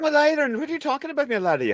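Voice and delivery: high-pitched put-on Scottish brogue; playful Scottish accent, pitched well up. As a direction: lay on a Scottish lilt, high and teasing. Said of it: "I can do a Scottish accent".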